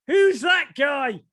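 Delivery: high, loud, drawn-out mock shout; shouted question. Descendant pointing at headshot, acted.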